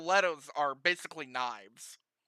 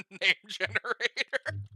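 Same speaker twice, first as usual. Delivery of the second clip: ordinary talk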